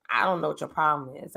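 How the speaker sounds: low, quiet, puzzled husband. Retold or imitated. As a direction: mumble it like a baffled husband. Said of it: "he'll be like".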